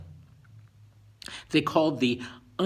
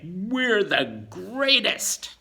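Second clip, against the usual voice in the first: slow, higher and louder boast; boastful; 8 semitones higher, 6 dB louder, much slower than usual. Acted. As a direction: gloat like you own the world. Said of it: "they'll say".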